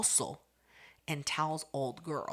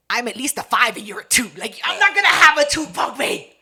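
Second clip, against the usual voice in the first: high, loud comeback in a fight; retold angry yell; 5 semitones higher, 13 dB louder than usual. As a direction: yell it back at the bully. Retold schoolyard quarrel, yelling back.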